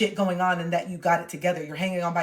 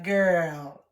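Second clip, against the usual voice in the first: sassy talk to self; 2 semitones higher, 5 dB louder than usual. Pretend. To her past self, mock giveaway sass. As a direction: hand out hoops like a host. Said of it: "I just look at it like".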